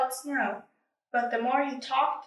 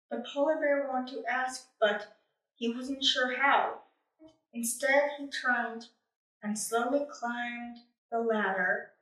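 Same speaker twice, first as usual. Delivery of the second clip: plain reading voice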